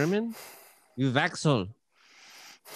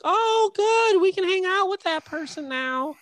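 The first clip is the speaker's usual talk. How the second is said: much higher squeaky mock of people. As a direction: mimic them in a high silly voice. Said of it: "They're like".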